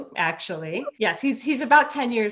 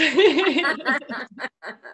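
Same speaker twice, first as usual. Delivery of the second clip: high real laugh at the joke; bright genuine laughter; 7 semitones higher, 4 dB louder than usual. Real, not acted. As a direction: laugh along with the host.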